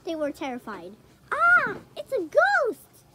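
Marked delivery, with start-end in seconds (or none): 1.3-3.0 high, loud, slow scared shout; frightened cry. Acted. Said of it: "they cried".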